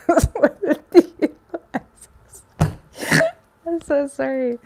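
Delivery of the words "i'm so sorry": laughing apology. Real, not acted. Tarot reader cracks up mid-reading.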